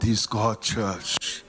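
ordinary talk, nothing marked

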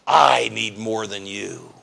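low slow proud voice. Retold or imitated. Voicing prideful covetousness.

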